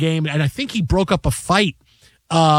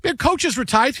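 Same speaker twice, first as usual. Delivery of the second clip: ordinary talk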